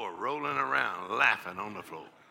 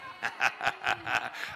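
breathy laughing fit; 6 semitones lower, 4 dB quieter than usual. Acted. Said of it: "faith it".

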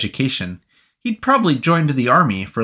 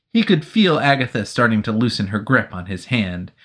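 ordinary delivery, nothing marked

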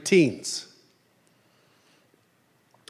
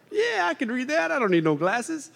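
pitched up high and soft, pleased with himself; mock delighted surprise; 9 semitones higher, 5 dB quieter than usual. Preacher playing himself without glasses, mock delighted. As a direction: act amazed you can finally read it, lightly. Mock self with bigger font.

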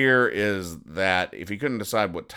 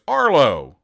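raised, high-pitched call of a name; 7 semitones higher, 8 dB louder than usual. Acted. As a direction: call out sharply to your husband. Wife calls out to husband.

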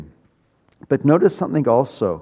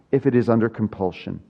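ordinary talk

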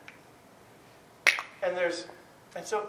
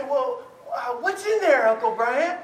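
put-on high, loud child's question; eager niece; 4 semitones higher, 5 dB louder than usual. Niece asks uncle about the present, high eager voice. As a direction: imitate an excited little niece.

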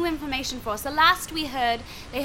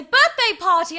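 higher, loud mock-cheerful call; called-out suggestion; 4 semitones higher, 9 dB louder than usual. Shown as a demonstration. Improviser covering a rude suggestion from kids, called out loud. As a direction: call it out over the kids' suggestion. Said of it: "I would say".

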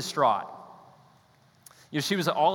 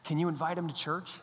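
soft, distant inner voice; a quiet nudge from somewhere else; God prompting him to invite them; God's voice; 9 dB quieter than usual. Retold or imitated. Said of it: "he's like".

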